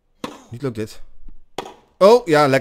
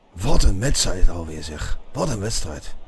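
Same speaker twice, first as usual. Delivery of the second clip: ordinary delivery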